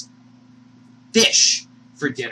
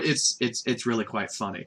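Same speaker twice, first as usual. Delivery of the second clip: ordinary talk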